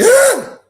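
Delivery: high, loud mock scream; sudden acted-out shriek; horrified yelp. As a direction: yelp as if seeing something disgusting.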